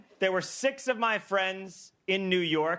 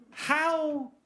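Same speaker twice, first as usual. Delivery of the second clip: high, halting mock bewilderment; bewildered question; 6 semitones higher, slower than usual. Client asking the dealer by text, bewildered question. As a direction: ask it haltingly, pitched up in disbelief.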